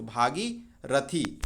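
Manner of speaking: भागीरथी slowly, long आ after भ; careful spelling-out pronunciation. Shown as a demonstration. Teacher to students, slow model of भागीरथी. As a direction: stretch भागीरथी syllable by syllable. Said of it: "भागी में बड़े की मात्रा रहेगा".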